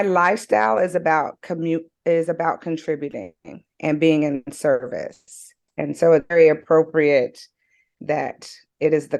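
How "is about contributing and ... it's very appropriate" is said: usual speaking voice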